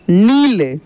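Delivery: नील with the ī stretched, rising then falling; drawn-out long ī. Shown as a demonstration. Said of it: "इसका उच्चारण खींच कर होता है". (नील की ई खींच कर)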